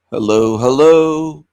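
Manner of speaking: loud, drawn-out hello to the chat; calling out. Real, not acted. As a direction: call slowly into the empty room. Calling out to silent viewers.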